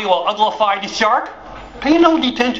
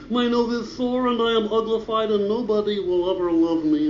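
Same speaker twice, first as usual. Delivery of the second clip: self-pity; 3 semitones higher, 4 dB quieter than usual. Acted. Abandoned ducky feels sorry.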